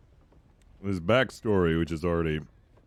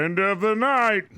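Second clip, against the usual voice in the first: character voice; 12 semitones higher, 4 dB louder than usual. Acted. In-game character reminder.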